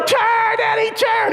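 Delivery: shrieked high warning; yelling. Retold or imitated. Kids yelling after their friend on the bike, desperate high scream. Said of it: "We're yelling".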